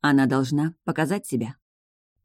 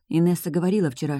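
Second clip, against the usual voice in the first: plain reading voice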